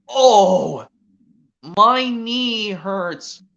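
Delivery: slow, loud mock groan; Spud groaning. Spud complains about his knee with a mock groan. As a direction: moan it out, hammed-up. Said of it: "Spud groans".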